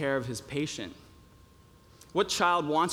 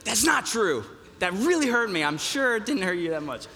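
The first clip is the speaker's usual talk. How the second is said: put-on protesting kid; 5 semitones higher than usual. Child protesting to dad in story.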